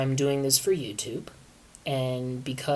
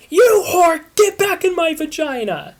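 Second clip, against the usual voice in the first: high, loud furious shout; angry yell; 9 dB louder than usual. Acted. Christina yells for Will to come out. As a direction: bellow at him to come out.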